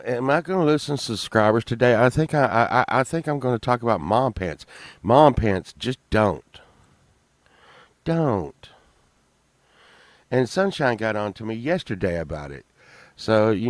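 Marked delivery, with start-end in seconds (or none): none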